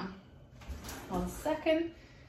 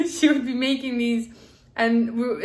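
ordinary talk, nothing marked

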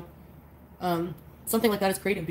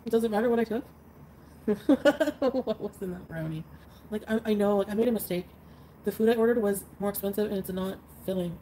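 ordinary talk

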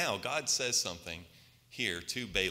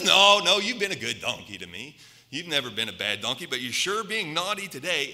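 a loud "No, no" that settles into a lightly voiced scolding; a mock-patient owner chiding his donkey; a hint of an exasperated rider; Balaam answering; 5 semitones higher, 6 dB louder than usual.

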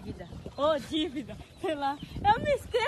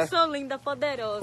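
loud proclamation; 13 dB louder than usual. Shown as a demonstration. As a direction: shout it out proudly to the crowd. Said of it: "Olha pro povo e diga assim".

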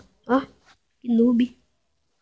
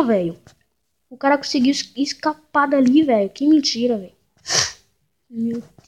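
ordinary talk, nothing marked

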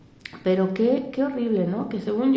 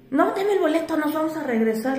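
angry driver; 7 semitones higher than usual. Retold or imitated. Driver demanding ticket, retold.

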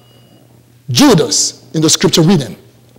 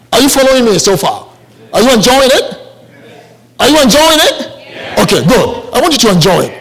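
ordinary talk

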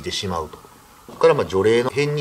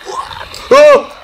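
loud panicked scream; fright scream; 13 dB louder than usual. Retold or imitated. Driver seeing the ghost at the window, loud terrified scream. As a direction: scream out in sudden terror.

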